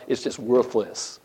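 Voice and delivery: ordinary talk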